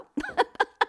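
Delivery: high-pitched burst of laughter; short, high real laugh. Real, not acted. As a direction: laugh out in relief at surviving. Laughs at having survived.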